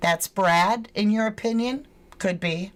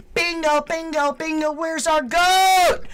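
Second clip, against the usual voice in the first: celebrating the match at the top of the voice; excited; 6 semitones higher than usual. Real, not acted. Vlogger to viewers after the thumb match, giddy cheering.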